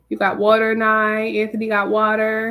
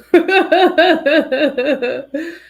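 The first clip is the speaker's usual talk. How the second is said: laugh; 6 semitones higher, 9 dB louder than usual. Real, not acted. Laughs at a viewer's food order.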